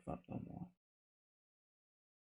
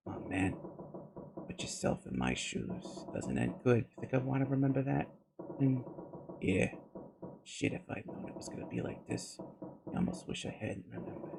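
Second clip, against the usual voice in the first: subdued; 8 dB quieter than usual. Acted. Seven erinnert sich bedrückt.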